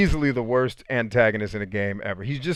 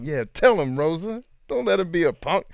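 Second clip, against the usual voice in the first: higher, playful hype-man cheer; mock urging, pitched up; egging her on; 7 semitones higher than usual. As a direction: back her up from the sidelines. Cheering Rosa on in the story.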